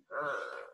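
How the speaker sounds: low growl; a deep dog growl. Acted. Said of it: "she growled".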